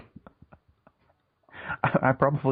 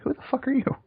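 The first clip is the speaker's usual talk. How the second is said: shrill shocked mom. Retold or imitated. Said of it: "my mom's just like".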